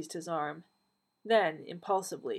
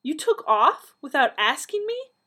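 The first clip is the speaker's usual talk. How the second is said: much higher and louder, outraged; voice rising; 9 semitones higher, 10 dB louder than usual. A narrator's reading. Donna to the Doctor on learning he left, indignant voice rising. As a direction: shoot the voice up in indignant disbelief. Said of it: "Donna's voice rose".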